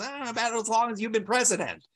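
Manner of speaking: a quick mock Brooklyn quip; cheeky Brooklyn twang, lightly imitated; Brooklyn accent. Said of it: "in her Brooklyn accent".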